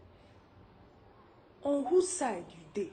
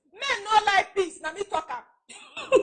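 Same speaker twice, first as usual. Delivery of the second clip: high, insistent declaration ending in laughter; emphatic insistence; 9 semitones higher than usual. Acted. Wife mocks friend's naive advice.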